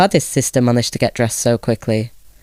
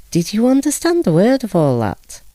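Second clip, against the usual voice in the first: a lightly voiced suspicious clerk, a touch higher; a hint of a puzzled official asking the parents; chief clerk; 8 semitones higher than usual. A narrator's reading. Chief clerk asks Gregor's parents, lightly voiced and puzzled.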